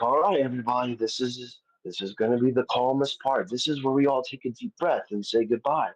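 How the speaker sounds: calm vet voice. Retold or imitated. The vet staff calming the family before putting the dog down, softly. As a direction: talk the family gently through goodbye.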